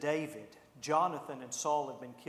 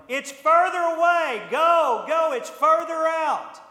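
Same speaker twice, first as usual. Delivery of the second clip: full shout, much higher and louder, 'further' stretched out; yelling; 12 semitones higher, 15 dB louder than usual. Retold or imitated. Arrow boy yelling 'further' to the field, full shout. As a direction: holler 'further' across the field. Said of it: "he started yelling".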